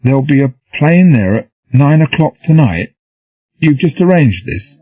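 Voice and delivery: high, loud disbelieving repeat; dazed question. A narrator's reading. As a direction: echo it back, baffled. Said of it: "repeated the statement in the form of a question".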